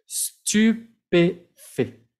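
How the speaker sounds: Stupéfait very slow, ending on an open è; slow demo. Shown as a demonstration. Teacher modelling Stupéfait slowly. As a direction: stretch Stupéfait syllable by syllable. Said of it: "como una E al final".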